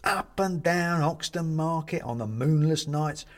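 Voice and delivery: high, strong character voice; reassuring china man. A narrator's reading. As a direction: comfort a confused newcomer heartily.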